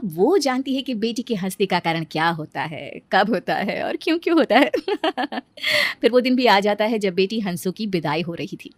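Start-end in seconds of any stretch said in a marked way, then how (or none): 4.8-5.4 short high, soft laugh; amused high titter; soft giggle. Acted. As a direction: let out a little giggle. Giggle after narration.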